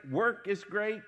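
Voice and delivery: usual speaking voice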